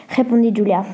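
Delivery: ordinary delivery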